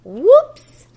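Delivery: high, loud sudden whoops. A narrator's reading. Teacher reading to children, startled loud whoops. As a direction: yelp it as you tip over. Sled tumble in story.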